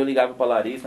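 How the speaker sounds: normal talking voice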